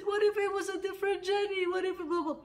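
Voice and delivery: high, loud pleading whine; high-pitched, insistent what-if; retold tearful protest. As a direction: re-enact a child protesting through tears.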